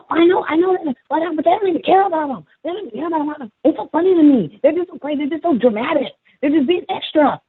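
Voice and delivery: high, squeaky, insistent mockery; dismissive teacher's assistant. Teacher's assistant brushing off the crying kids, dismissive high mock voice. Mimics assistant talking over them.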